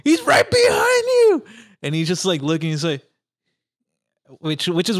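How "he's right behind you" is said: high, loud, slow warning scream; panicked shout. Retold or imitated. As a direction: yell the warning in terror. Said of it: "she's like".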